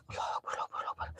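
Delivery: hushed, breathless Allahu Akbar; whispered prayer. Real, not acted. Takut sendirian, berdoa berbisik. (doa berbisik ketakutan)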